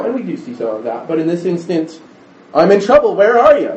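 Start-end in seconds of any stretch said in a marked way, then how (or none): 2.5-3.7 loud, high-pitched demand; raised voice, blaming the absent helper; accusing attitude. Acted. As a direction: cry out in indignant accusation. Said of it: "that's the attitude".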